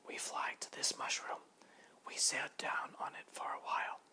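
soft breathy whisper, no voice; three little butterflies whispering their claim. A narrator's reading. Butterflies telling Grasshopper to move, in a soft whisper.

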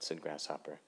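ordinary delivery

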